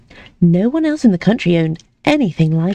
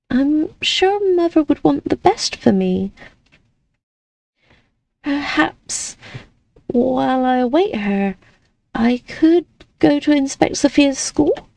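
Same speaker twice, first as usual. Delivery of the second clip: put-on light, higher girlish voice; young lady voice; 7 semitones higher than usual. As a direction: play the sweet, naive young lady. Amelie pleading hopefully.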